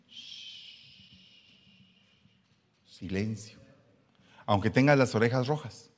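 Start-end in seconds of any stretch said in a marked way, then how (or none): 0.1-1.2 long breathy shush; whispered shush. Shown as a demonstration. Preacher shushing the congregation, whispered shush. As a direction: hush the room with a soft shush.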